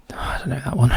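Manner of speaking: breathy private murmur, no voice; whispered aside. Real, not acted. Solver admitting to himself he is stumped, whispering. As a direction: whisper it to yourself, half puzzled. Muttering to self over clue.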